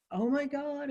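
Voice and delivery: high, gushing Oh my God; retelling a burst of delight; thrilled exclaiming. Retold or imitated. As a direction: squeal it out to your husband, overjoyed. Bouncing down stairs to husband.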